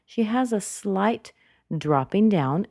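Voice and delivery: usual speaking voice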